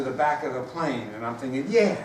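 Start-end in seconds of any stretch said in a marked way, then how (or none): 1.7-2.0 gleeful sarcasm. Retold or imitated. Sarcastic inner voice, building up.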